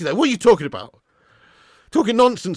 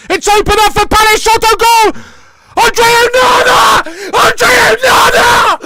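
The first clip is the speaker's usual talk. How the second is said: full-throated high yelling at the save; ecstatic shouting; 12 dB louder, slower than usual. Real, not acted. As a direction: scream it like the keeper just saved the match. Fan reacts to a double save.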